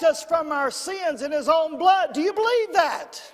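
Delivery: rising, fervent preacher's proclamation; full-force preaching. Real, not acted. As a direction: build to a high, loud call for amens.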